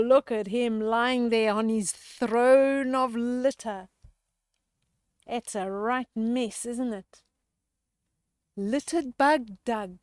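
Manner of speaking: plain reading voice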